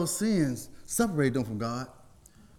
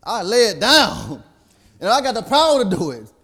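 louder, rising preacher's cry; preaching at full force; 5 semitones higher, 6 dB louder than usual. Acted. Preacher voicing Jesus' power over death, loud. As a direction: proclaim it with triumph.

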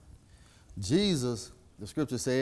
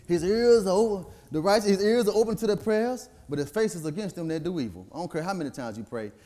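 ordinary talk

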